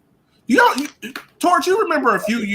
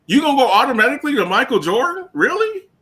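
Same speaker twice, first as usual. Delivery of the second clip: incredulous; 5 semitones higher than usual. Real, not acted. Incredulous at comparison to Jordan.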